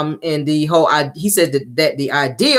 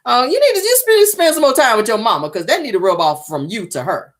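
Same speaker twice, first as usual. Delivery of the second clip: exasperated retort; 7 semitones higher, 3 dB louder than usual. Real, not acted. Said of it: "I was like".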